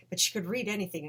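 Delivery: normal talking voice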